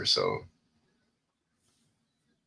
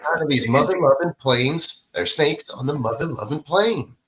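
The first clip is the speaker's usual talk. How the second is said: Samuel L. Jackson impression; 7 semitones higher than usual. Quoting Snakes on a Plane.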